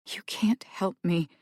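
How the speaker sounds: hopeless whispered mumble; mumbled whisper. Acted. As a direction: mumble it under your breath. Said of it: "Rowan mumbled".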